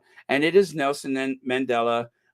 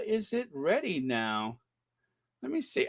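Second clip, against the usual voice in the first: ordinary talk, nothing marked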